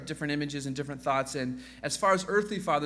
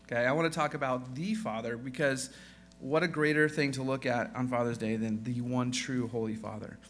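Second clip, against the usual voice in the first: ordinary talk, nothing marked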